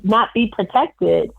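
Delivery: ordinary talk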